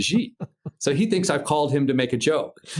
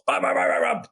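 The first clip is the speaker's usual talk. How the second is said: rapid mouthed rotor chop; imitated helicopter; 4 semitones higher than usual. Helicopter booming up into the air, imitated rotor chop. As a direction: mimic blades pounding as it lifts off. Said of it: "The helicopter just goes".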